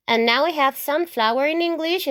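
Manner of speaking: ordinary delivery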